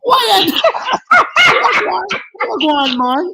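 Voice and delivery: high, loud Southern grandma greeting; imitating grandma. Friend imitating his buddy's grandma, high and loud. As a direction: belt it out like an old grandma calling a boy. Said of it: "she's like".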